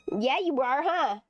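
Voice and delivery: ordinary talk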